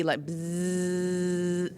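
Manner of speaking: long voiced buzzing z; bee buzz. Shown as a demonstration. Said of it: "make a z sound like a bee".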